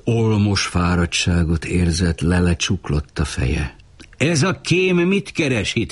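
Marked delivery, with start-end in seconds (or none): 4.2-5.9 sudden high-pitched cry, much higher; high shout. A narrator's reading. Someone in the crowd spots a spy, shouting high. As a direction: shriek the question up high. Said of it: "kiáltotta valaki magas hangon".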